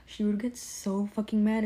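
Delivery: ordinary delivery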